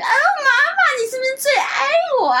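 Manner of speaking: high, loud cutesy plea, laughing; hammed-up wheedling call to mom; coaxing daughter. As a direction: whine at mom to be loved most. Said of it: "撒娇".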